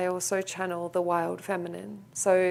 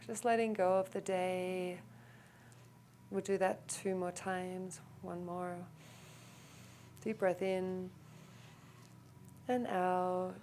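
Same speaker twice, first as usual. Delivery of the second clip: calm, softer meditation voice with long pauses; guided meditation; 8 dB quieter than usual. Real, not acted. Teacher guiding the group into a clearing breath, calm meditation voice.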